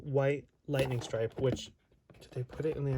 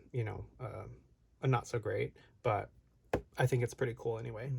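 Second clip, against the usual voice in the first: ordinary talk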